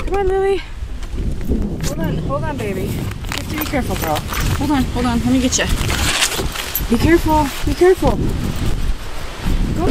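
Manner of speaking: high urgent coax to the dog; careful, careful, high and tense; anxious dog coaxing. Real, not acted. Guiding dog on ice.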